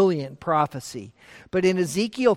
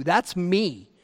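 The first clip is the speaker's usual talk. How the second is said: normal talking voice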